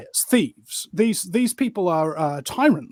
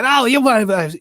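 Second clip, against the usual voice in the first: put-on sneering young comedian; 5 semitones higher, 3 dB louder than usual. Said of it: "He goes".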